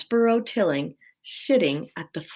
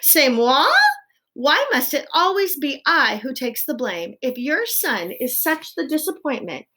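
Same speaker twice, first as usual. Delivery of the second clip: high, affronted protest; indignant wife; 8 semitones higher, 5 dB louder than usual. A narrator's reading. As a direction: protest the blame in raised pitch. Wife indignant at being blamed.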